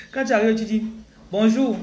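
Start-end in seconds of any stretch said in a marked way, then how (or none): 1.3-1.8 put-on playful Gabonese accent, a bit higher and louder; imitation of a Gabonese greeting. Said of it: "Je fais l'accent gabonais".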